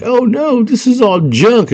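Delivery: hammed-up alarmed realization; mock dismay. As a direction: cry out high as the illusion breaks. Girl realizes room is junk.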